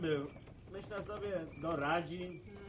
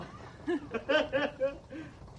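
knowing laugh; 3 semitones higher than usual. Acted. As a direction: chuckle at a cheeky joke. Man laughs at innuendo.

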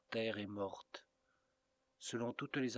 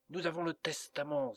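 sudden exclamation; 6 semitones higher, 3 dB louder than usual. A narrator's reading. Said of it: "s'écria-t-il soudain".

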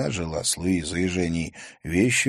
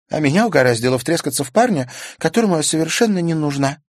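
put-on higher young girl's voice, rueful; girl Karina voiced; 10 semitones higher, 7 dB louder, faster than usual. A narrator's reading. Karina admits her foolishness to Stas, higher girl's voice. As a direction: play Karina, higher and lighter, sheepish.

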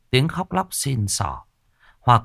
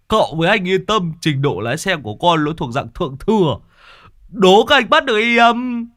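high and loud bragging; cocky boast; 9 semitones higher, 5 dB louder than usual. Acted. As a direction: show off, shouting with glee. Driver boasts wildly. (giọng khoác lác hả hê)

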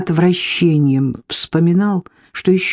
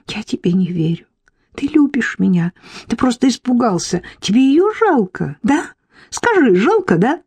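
insistent questioning climbing much higher; jealous pleading; 5 semitones higher than usual. Acted. Irka begs her lover to admit he pities her, jealous pleading. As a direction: press for an answer, pitch rising as you go. Ревнивый допрос любовника.

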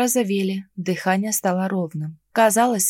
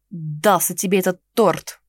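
зло; 2 semitones lower than usual. Acted. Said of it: "зло проговорил Генрих".

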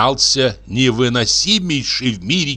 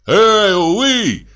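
high, carrying hail; calling out; 11 semitones higher, 3 dB louder than usual. A narrator's reading. Men hailing from another carriage, calling out. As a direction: call across to the other carriage. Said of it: "крикнули с другого тарантаса".

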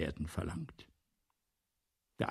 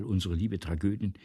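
ordinary talk

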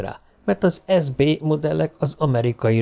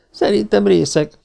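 high, louder stubborn insistence; 10 semitones higher, 4 dB louder than usual. Acted. Donovan insists the robot is drunk, stubborn. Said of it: "kötötte az ebet a karóhoz".

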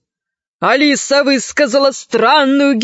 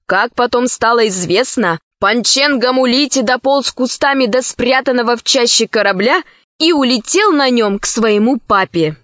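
usual speaking voice

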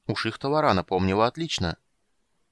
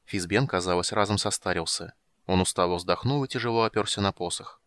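ordinary delivery

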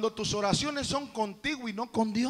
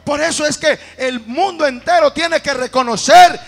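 very loud, rising doxology; booming pulpit climax of praise; impassioned preaching; 3 semitones higher, 12 dB louder than usual. Real, not acted. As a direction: preach it at full force. Climax of sermon, praising God.

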